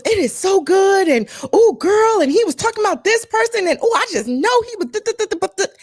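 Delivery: put-on high, fast gushing girlfriend; very high rapid excited chatter; raving friend. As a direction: imitate a friend who can't contain herself. Friend raving about the album.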